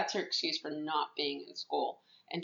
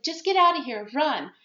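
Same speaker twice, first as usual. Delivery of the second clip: higher, louder warning; urgent retold cry; 7 semitones higher, 5 dB louder than usual. Girl urging friends to flee a fight, urgent retold cry. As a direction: urge them out, acted lightly.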